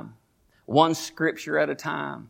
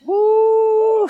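joyful whoop; 5 dB louder than usual. Real, not acted. Climax of shouted preaching.

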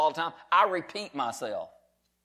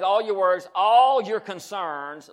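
ordinary delivery

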